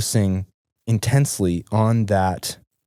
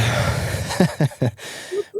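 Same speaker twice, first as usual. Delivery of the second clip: breathy laugh; 8 semitones higher than usual. Real, not acted. Laughing at being told keep going.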